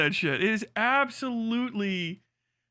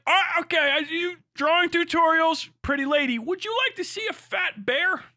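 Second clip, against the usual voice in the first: high, goofy mock voice of the website offering a fat bear; lightly voiced cheery salesman; mock app voice; 9 semitones higher than usual. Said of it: "And they're like".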